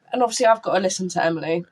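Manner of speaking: usual speaking voice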